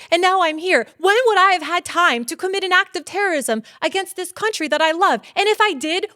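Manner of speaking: high, loud, pleading outburst; desperate protest. Retold or imitated. Protesting to the polygraph examiner, loud and pleading. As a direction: argue your innocence near tears. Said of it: "And I'm like".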